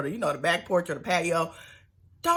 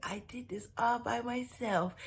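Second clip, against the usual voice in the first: put-on higher, babyish, soft little-boy voice; little kid voice; 4 semitones higher, 8 dB quieter than usual. Mocking Tony's babyish boast to Angela, little kid voice. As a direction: talk like a proud little kid. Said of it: "Tony talked like a little kid".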